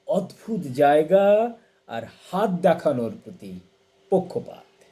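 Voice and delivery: measured poetry reading, pausing after each item; deliberate, expressive verse reading with spaced phrases; poem recitation. Acted. Reads a translated poem aloud.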